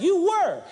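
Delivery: were punched loud and high; stress on were. Shown as a demonstration. Preacher quoting scripture, punching were loudly. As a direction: hit the word were hard. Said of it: "He said".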